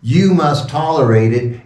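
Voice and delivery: normal talking voice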